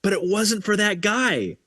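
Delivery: the penny drops in delight; imitating an overjoyed discovery; amazed realization. Sudden realization retold.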